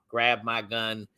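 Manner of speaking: normal talking voice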